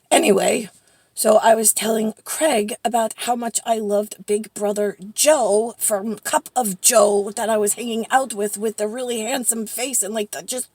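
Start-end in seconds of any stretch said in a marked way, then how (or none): none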